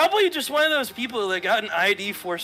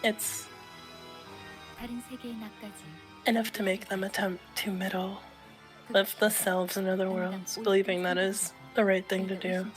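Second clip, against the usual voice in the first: softly measured character read; understated, soft dramatic reading; quiet game character; 7 dB quieter than usual. Acted. Reads game dialogue in character.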